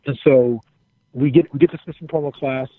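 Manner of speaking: ordinary talk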